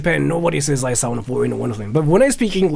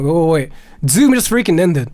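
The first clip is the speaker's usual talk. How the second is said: usual speaking voice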